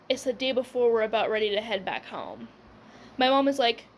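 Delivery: ordinary delivery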